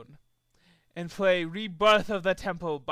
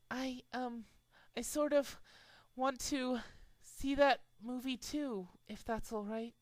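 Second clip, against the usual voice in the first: high, much softer shy plea; timid; 5 semitones higher, 9 dB quieter than usual. A narrator's reading. Fluttershy asking to join, high and very soft. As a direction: ask barely daring to.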